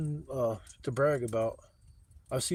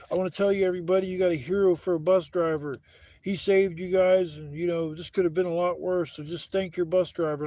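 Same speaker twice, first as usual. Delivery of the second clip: higher, louder public address to the passengers; manager's announcement; 4 semitones higher, 7 dB louder than usual. Retold or imitated. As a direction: boom it out like a manager praising a hero. Manager addresses the whole bus.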